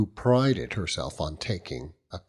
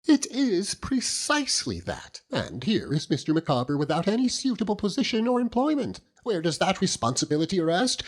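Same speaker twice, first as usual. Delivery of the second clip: high, earnest matronly lady voice; high-pitched loyal wife holding forth; Mrs Micawber; 14 semitones higher than usual. Acted. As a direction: lift into the proud, insistent wife.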